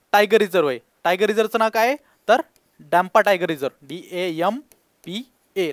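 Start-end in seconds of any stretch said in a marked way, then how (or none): 3.9-5.7 slow letter-by-letter spelling; spelled out letter by letter. Shown as a demonstration. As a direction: spell डीएएमपीए slowly, one letter at a time. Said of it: "लक्षात ठेवायचं आहे". (अक्षरं हळू एकेक करून सांगा)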